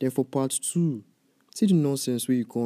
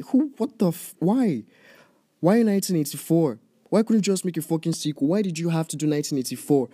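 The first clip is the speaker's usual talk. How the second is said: higher, louder, disbelieving why; exasperated outburst; 6 semitones higher, 3 dB louder than usual. Real, not acted. Vlogger raging at Patty Jenkins over the setting, shouting exasperated. Outraged rant at the director.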